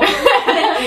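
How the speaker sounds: high giggling fit; laughter. Real, not acted. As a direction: crack up over the blunder. Laughing at friend's mistake. (risa aguda incontenible)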